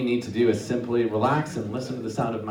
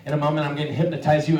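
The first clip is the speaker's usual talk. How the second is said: ordinary talk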